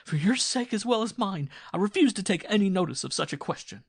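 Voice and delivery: wife gaining the upper hand, defiant. A narrator's reading. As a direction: stand up to him. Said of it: "she raised her voice".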